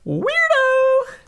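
shrieked squeaky insult in falsetto; high-pitched shout. Retold or imitated. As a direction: jump way up high and yell it. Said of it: "enough high-pitched stuff from me".

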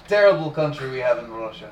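deadpan mock Russian accent. Griping about Russia, deadpan mock Russian accent. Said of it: "I go in this accent the rest of the night".

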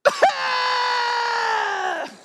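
loud, high, sustained imitation wail; mock bawling wail. Checker just loses it when comforted, imitated high wail. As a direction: burst into an exaggerated long cry. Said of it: "she's bawling her eyes out".

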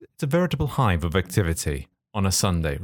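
ordinary talk

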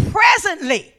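"Presently" called out loud and falling; emphatic. Acted. Preacher stressing presently to the congregation, called out loud. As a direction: proclaim "Presently" as the answer.

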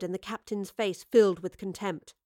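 ordinary delivery

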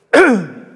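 sharp, loud, real cough fit as he chokes up; real choking cough. Real, not acted. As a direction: caught by a sudden cough mid-sermon. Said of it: "sorry, I got all choked up".

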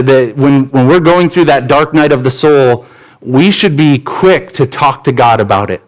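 ordinary talk

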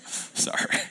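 sorry said on a quick laugh; amused, quiet apology. Real, not acted. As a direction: apologise through a giggle. Laughs while apologising to audience.

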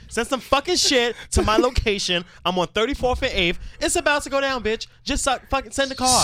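high angry yelling; furious caller. Retold or imitated. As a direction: rage at the operator. Mock caller losing it.

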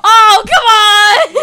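loud, high excited outburst; excited shout. Real, not acted. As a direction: shout it with delight. Excited reaction to [name].

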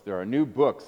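ordinary talk

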